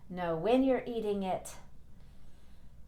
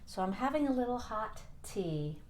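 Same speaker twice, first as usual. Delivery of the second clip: usual speaking voice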